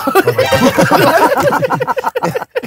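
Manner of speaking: long, high burst of laughter. Real, not acted. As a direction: break up laughing.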